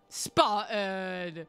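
name stretched very slowly; drawn-out call. Real, not acted. Streamer thanks a viewer, drawn-out call. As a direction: call SPARTAN with long held syllables.

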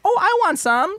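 very high playful grandma voice; high-pitched mock baby talk; imitated doting grandma. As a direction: put on a grandma teasing a toddler. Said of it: "She's like".

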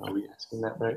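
very soft private check; hushed aside. Real, not acted. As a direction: murmur it privately to your partner. Private check between interviewers.

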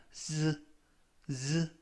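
"즈" as a buzzing voiced z; voiced z sound. Shown as a demonstration. Teacher showing learners how S sounds here, voiced z "즈". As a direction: demonstrate "즈" with a voiced z. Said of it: "발음이 납니다".

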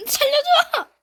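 high panicked screams for help; terrified horror victim. Real, not acted. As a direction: scream for your life, very high and loud. Panicked screams while dying in game.

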